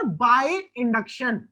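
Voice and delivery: By induction dragged out very slowly; very slow drawn-out answer. Shown as a demonstration. Teacher drilling the class on the third method, very slow. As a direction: stretch By induction out slowly, word by word. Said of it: "तीसरा कैसे कर सकते हैं sir?".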